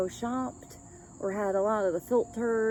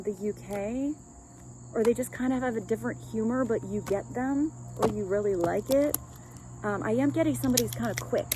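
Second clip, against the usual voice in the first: ordinary talk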